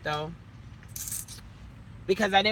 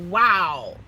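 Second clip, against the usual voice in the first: amazed wow; 8 dB louder than usual. Retold or imitated. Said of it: "I was like".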